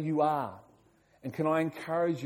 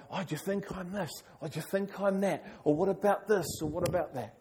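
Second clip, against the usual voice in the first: higher-pitched, acted-out dithering; wavering doubter; 5 semitones higher, faster than usual. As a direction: mimic someone tossed between rival opinions. Acts out a double-minded person's wavering questions.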